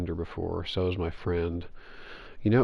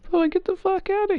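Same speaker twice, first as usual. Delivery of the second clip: high, loud disbelieving shout; mock exasperated yell; 4 dB louder than usual. Gamer retelling his reaction to a last-second catch, high loud mock yell. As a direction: yelp it in hammed-up disgust.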